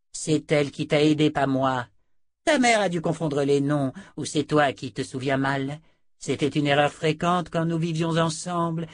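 lightly voiced, emphatic sorceress; haughty sorceress. A narrator's reading. Sorceress explains the mix-up with her sister, haughty. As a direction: correct her with a touch of impatience.

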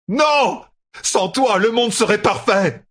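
high, anguished yell; desperate shout. A narrator's reading. Rand defies the voice in his head, shouting. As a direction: scream it back in defiance. Rand screams back in refusal.

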